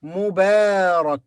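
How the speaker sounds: mubarak with a long middle a; mubarak, drawn-out a. Shown as a demonstration. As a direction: say mubarak, holding the a. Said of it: "followed by long vowel a".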